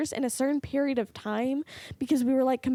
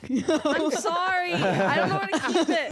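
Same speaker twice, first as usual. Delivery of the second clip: giggling through an apology; can't keep it together, laughing; 3 semitones higher than usual. Real, not acted. Friend apologizes while giggling at the story, laughing. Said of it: "why are you laughing?".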